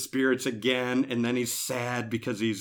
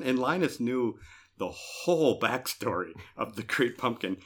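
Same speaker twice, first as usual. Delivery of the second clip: ordinary talk, nothing marked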